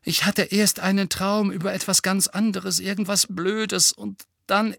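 high-pitched, urgent explanation; agitated. Acted. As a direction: plead to be understood. Boy urgently explains his dream. (aufgeregt, mit hoher Stimme)

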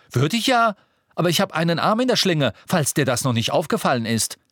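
high, fast, heated comeback; angry retort. A narrator's reading. Ron with arm in sling answers Harry, angry. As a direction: fire back indignantly, starting very high.

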